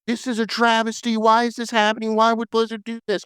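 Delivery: high, whiny cry of betrayal; mock outraged fans. Fans protesting Blizzard's change in a high mock wail. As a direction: wail it like a fan whose world just ended. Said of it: "everybody was like".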